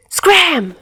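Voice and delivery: high urgent shout; yelled command. Acted. Albatross telling the others to run, high shout. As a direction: yell it and bolt. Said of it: "Albatross yells".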